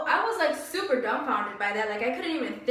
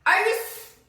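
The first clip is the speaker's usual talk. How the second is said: shrill, loud, outraged disbelief, much higher; 10 semitones higher, 8 dB louder than usual. Retold or imitated. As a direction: throw back a stunned are you crazy. Shocked retort to the boss.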